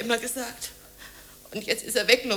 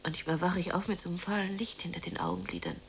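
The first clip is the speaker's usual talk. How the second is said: soft, lower, unvoiced whisper; hushed whisper; 4 semitones lower, 10 dB quieter than usual. Woman alone in her dark room, whispering to herself.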